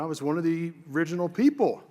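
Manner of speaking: ordinary talk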